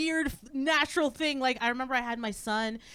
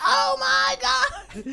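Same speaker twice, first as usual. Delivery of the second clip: put-on high, loud, stretched-out child's cry; mock confused panic; 14 semitones higher, 7 dB louder, much slower than usual. Mother acting out son losing first tooth, mock panic. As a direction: imitate a kid's bewildered alarm. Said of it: "all confused. He was just like".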